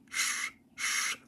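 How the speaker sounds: soft unvoiced steam hiss. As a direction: hiss out like an engine. Steam sounds.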